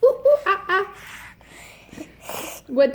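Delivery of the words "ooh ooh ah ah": high hooting ooh ooh ah; monkey noises. Shown as a demonstration. Parent demos monkey sound.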